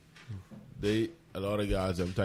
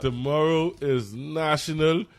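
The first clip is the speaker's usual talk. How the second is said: mock proclamation; 7 semitones higher, 9 dB louder than usual. Radio host declaring a made-up holiday, loud mock proclamation. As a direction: proclaim the joke holiday with full force.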